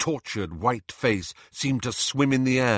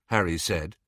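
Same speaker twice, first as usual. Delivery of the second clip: usual speaking voice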